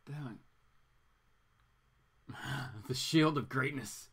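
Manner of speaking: usual speaking voice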